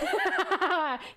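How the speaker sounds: high-pitched giggle. Real, not acted. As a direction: burst of giggling. Laughing at own joke.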